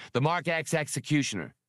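plain reading voice

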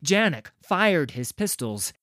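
ordinary delivery, nothing marked

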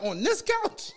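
high-pitched incredulous question; retold disbelief. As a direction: act out your shocked double-check. Retold shock at coworker's story.

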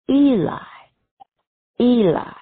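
Jesus crying out loud. Retold or imitated. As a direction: call out to God in anguish.

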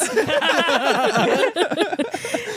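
a short scoffing laugh sound; mocking, unimpressed laugh; mock meh laugh. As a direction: scoff it off like mom did. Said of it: "like that sound".

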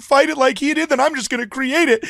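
mocking journalist voice. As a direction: play a self-righteous activist reporter, mocking.

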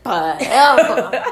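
drawn-out word breaking into laughter; long, laughing catchphrase. Acted. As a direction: stretch it way out, playful quote. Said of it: "what [name] said?".

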